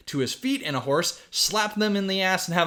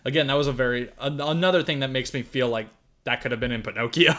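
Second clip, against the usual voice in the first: ordinary talk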